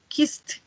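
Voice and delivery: "kissed" as one syllable ending in t; final T. Shown as a demonstration. Teacher drills past forms, "kissed" ending in a clear T. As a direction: add a released T to close "kissed". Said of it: "Al final suena como una T".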